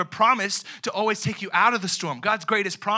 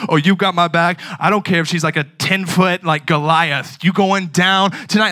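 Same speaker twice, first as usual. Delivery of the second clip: mock bravado; 10 dB louder than usual. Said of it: "with those four words".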